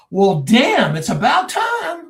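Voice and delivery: loud burst climbing very high; retold exclamation. Man at his door greeting long-awaited investigators, retold loud and high. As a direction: belt it out, drawing out the end. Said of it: "Come in, sit down".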